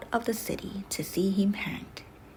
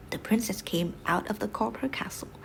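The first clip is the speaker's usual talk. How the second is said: ordinary delivery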